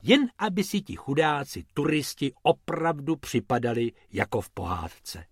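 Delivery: plain reading voice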